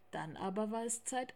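ordinary delivery, nothing marked

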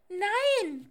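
high, loud owlet squeak; squeaky refusal. Acted. Little owl refuses the voice at the door, squeaky and loud. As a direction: squeak a firm no. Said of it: "piepste die kleine Eule".

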